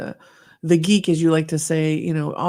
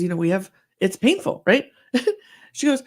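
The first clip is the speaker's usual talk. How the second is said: ordinary talk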